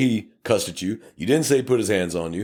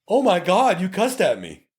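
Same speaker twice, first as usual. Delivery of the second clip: shocked; 11 semitones higher than usual. Retold or imitated. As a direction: play it scandalised and stunned. Driver shocked at being cussed at.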